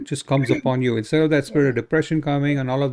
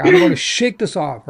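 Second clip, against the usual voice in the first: lifted, louder declaration; bold, higher and louder, shaking it off; a determined cry of release; 6 semitones higher, 4 dB louder than usual. Shown as a demonstration. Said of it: "just go like that".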